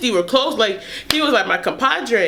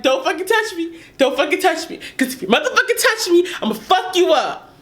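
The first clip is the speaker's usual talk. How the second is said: angry threat; 6 semitones higher, faster than usual. Retold or imitated. Partner grabs knife.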